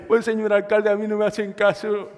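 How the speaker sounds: mock tearful whine to the mayor; whiny crying. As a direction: complain like a crybaby. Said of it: "Se va para la casa llorando".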